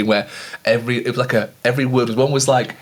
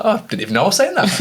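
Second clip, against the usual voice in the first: laughing mock innocence; 10 semitones higher, faster than usual. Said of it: "He was like".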